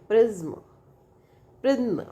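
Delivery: "प्रिज्म" with a small gap before the final m; space between syllables. Shown as a demonstration. Teacher showing where the space is, syllables set apart. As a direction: let a short schwa separate z and m in "प्रिज्म". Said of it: "इसके बीच में ये space है".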